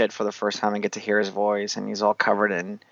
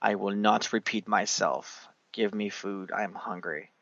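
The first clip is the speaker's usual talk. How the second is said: imitated stern king; 8 dB quieter than usual. King turning on his servants for food, imitated quiet menace. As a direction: quietly lay down the law as king. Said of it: "the king turns around ... he's like".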